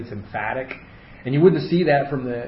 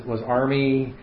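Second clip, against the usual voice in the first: usual speaking voice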